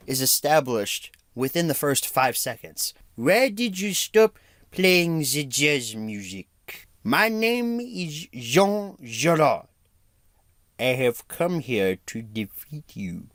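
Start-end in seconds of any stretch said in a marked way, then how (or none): 3.1-13.3 slow, mock French accent. Quoting Jean Girard at the bar, slow mock French accent. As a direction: do a hammed-up French villain. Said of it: "Why is he talking like that?".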